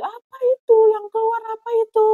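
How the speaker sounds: high, loud, rushed mock shriek; imitated frightened toddler. Toddler seeing what came out, high mock-frightened squeal. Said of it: "Dia takut, dia jerit-jerit".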